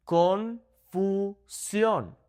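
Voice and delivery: Confusión split into kon, foo, SYON; very slow, high "Confusión" in separate syllables. Shown as a demonstration. Teacher breaks it up for learners, slow syllables of Confusión. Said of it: "slow down and focus on the vowel".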